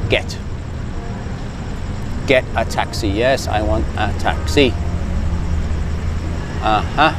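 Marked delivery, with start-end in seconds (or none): none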